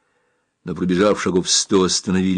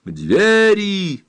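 high, drawn-out rallying call; shouted command; 10 semitones higher, 3 dB louder, much slower than usual. A narrator's reading. Rescuer sends men to the door, shouting. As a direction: call the order out, stretching it. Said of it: "закричал Самбельонг".

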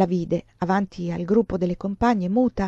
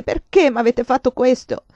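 crying; 5 semitones higher, 7 dB louder than usual. Acted. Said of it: "piangendo chiese".

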